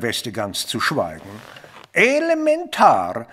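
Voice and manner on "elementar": a light mock Holmes, pitched much higher; a playful hint of the great detective. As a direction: tease him by borrowing his catchphrase. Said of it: "würde unser Mister Holmes hier sicher jetzt sagen".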